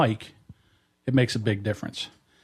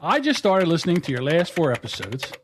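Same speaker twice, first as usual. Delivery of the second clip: measured reading, higher than chat; letter read aloud; 4 semitones higher than usual. Real, not acted. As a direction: read it out phrase by phrase, with pauses. Reads own letter aloud.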